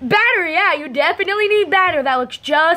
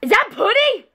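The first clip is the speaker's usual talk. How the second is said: much higher, crying out; outraged shriek; 10 semitones higher than usual. Real, not acted. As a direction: shriek at the betrayal. Outraged at being fed pudding.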